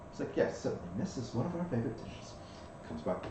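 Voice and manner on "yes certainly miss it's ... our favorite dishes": lightly voiced courteous waiter; polite waiter. GM as maitre d' serving the party, lightly courteous waiter. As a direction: offer the espresso like a smooth attendant.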